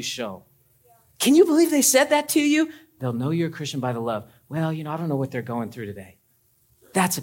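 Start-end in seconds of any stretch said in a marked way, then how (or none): 1.2-2.7 high, loud scandalized gossip; pitched-up indignant churchgoer; mock outrage. 4.5-6.2 calm gracious reply. Pretend.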